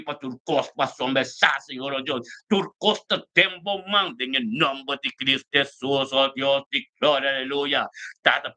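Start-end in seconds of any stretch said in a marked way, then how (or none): none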